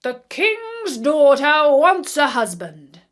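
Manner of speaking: a herald on horseback calling out to the streets; herald crying aloud. A narrator's reading. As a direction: proclaim it to the whole crowd. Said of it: "crying aloud".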